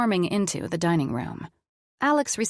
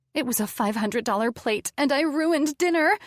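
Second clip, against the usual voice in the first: near tears; 7 semitones higher than usual. A narrator's reading. Woman blaming herself to her partner, tearful. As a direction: pitch climbing into an upset wail. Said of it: "I started crying".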